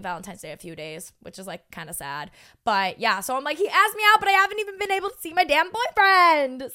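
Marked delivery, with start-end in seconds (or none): 3.6-6.7 loud, high comic whine; hammed-up frustration, pitched way up; exasperated complaint. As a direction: vent in mock outrage. Complaining she can't see new boyfriend.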